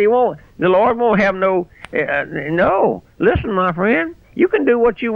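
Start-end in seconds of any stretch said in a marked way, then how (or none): none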